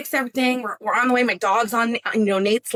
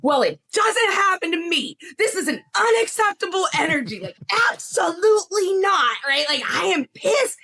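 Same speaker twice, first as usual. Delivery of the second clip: hammed-up outrage, pitched way up; mock furious yell; 10 semitones higher than usual. Wife yelling at unbothered husband about broken car, shouting.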